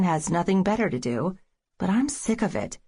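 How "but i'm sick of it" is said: sick pushed higher and hit hard; stress on sick. Acted. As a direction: lean on the word sick. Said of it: "Emphasis on sick".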